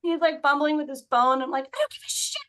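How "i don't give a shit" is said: very high, squealed retold outburst; exasperated yell. Woman in labor to fumbling husband, exasperated. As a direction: act out losing patience, voice way up.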